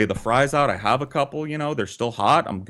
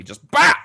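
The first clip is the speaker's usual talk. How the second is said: loud high bah for the slap; slap sound effect; 7 dB louder than usual. Retold or imitated. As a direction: burst out the noise of the hit. Imitating the slap.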